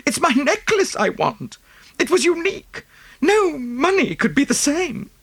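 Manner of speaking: high-pitched, upset society wife; tearful wife. A narrator's reading. Wife lamenting her unique necklace, high and upset. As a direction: wail it high, lingering on the last line.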